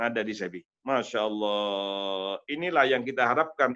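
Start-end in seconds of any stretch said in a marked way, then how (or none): 0.9-2.4 slow, low, reverent Masya Allah; drawn-out exclamation. Real, not acted. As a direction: stretch it out in awe.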